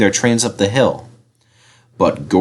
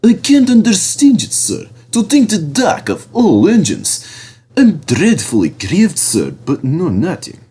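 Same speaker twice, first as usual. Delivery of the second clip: oily Diesel; 6 semitones higher than usual. A narrator's reading. Diesel pretends to the controller, high and oily. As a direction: lay on fake sorrow.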